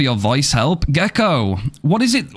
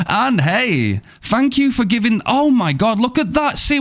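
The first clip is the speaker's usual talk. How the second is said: excited; 10 semitones higher than usual. Real, not acted. Hype gift pops up on stream.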